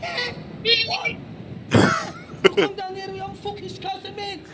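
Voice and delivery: exaggerated, high-pitched Scouse challenge; Scouser accent. Shown as a demonstration. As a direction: pick a fight in high Liverpool accent. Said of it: "So here's, I think, a Scouser".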